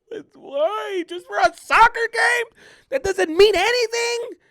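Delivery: high, loud imitation crying wail; tearful protest. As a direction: wail at the unfairness like an upset kid. Acting the upset crying kid.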